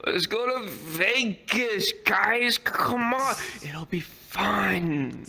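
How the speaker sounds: high, drawn-out mock coaxing; whiny plea with stretched words; drunken urging. Drunk friend talks pals into Vegas, mock urging.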